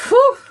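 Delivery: loud high whew of relief; real overwhelmed whew; high exhale whew. Real, not acted. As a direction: blow out the emotion. Host recovering from emotion.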